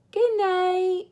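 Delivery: high goodnight. Acted. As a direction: lilt it up.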